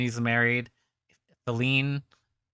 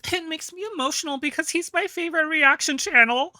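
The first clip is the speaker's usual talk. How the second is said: mocking imitation; 4 dB louder than usual. Host mocks guest's tearful words.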